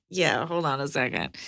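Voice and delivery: mock stalling. Mocking hosts stalling on money.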